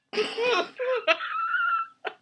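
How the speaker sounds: high burst of laughter; breaking into laughter. Real, not acted. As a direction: crack up mid-intro. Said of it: "not say that with a straight face".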